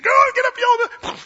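loud, high-pitched acted shout; angry yelling. Balaam beating his donkey, angry shouting. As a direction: yell as you beat a stubborn animal.

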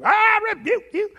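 hammed-up high shouted rebuke; evangelist shout. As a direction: bellow it like a showy TV preacher. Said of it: "They say it like that too".

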